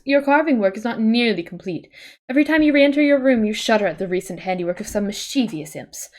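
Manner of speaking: steady second-person storytelling read; game narration. Acted. Reading the adventure's text to a friend, narrator voice.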